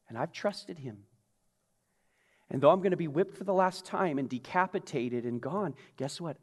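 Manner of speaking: soft, hushed certainty that fades to a whisper; trusting calm, dying away; Paul's quiet confidence. Retold or imitated. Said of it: "he says".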